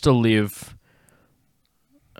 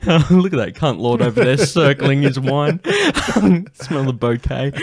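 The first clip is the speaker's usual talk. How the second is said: a higher, louder mock posh gawk; mocking snob; 5 semitones higher, 5 dB louder than usual. Mocking the goth swirling wine at Macca's, mock snob. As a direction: point him out like a scandalised snob.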